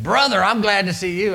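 loud, high, beaming preacher welcome; hearty preacher greeting. Retold or imitated. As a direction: greet him like a jolly Pentecostal preacher. Voicing the Pentecostal preacher.

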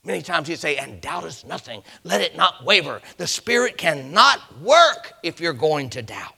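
high, loud pulpit cry; impassioned preacher in full cry; full-force preaching. Real, not acted.

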